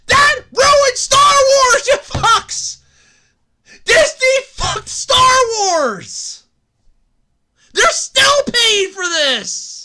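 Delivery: high, slow yelling at Disney; furious rant. Real, not acted. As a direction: scream the grievance, stretched out.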